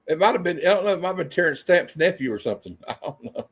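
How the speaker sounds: ordinary talk, nothing marked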